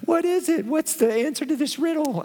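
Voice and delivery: high, pleading wife's voice; mock-whiny woman voice; best woman voice. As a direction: whine it up high like a wheedling wife. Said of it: "it's my best woman voice I've got".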